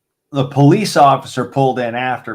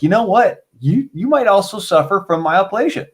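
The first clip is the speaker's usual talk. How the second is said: mom's voice; 7 semitones higher than usual. Retold or imitated.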